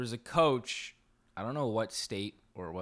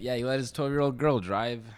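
ordinary delivery

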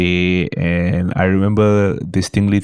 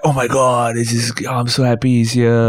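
higher, gushing fan voice; excited fan; 4 semitones higher than usual. Retold or imitated. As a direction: gush like a thrilled fan. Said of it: "to go like".

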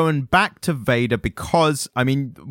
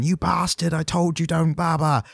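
retold scream; 7 semitones higher than usual. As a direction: mimic her screaming without real volume. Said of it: "She's screaming".

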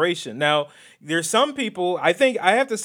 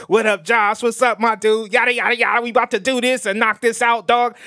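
hyped-up street greeting, higher pitched; street talk; 6 semitones higher, 3 dB louder than usual. Shown as a demonstration. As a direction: demo of loose buddy slang. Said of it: "I can't be on this podcast like".

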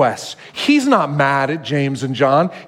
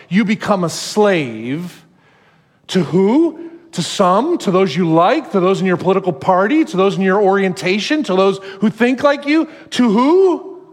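building sermon climax; 4 semitones higher than usual. Acted. Pastor drives the point home to the congregation, building. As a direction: build to a peak and land the line. Preaching climax, rising pitch.

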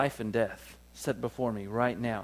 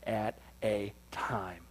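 whisper; 4 semitones lower, 9 dB quieter, much slower than usual. Acted. Sermon whispered emphasis.

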